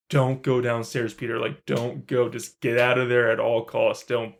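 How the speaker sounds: ordinary delivery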